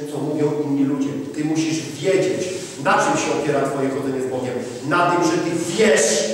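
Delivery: normal talking voice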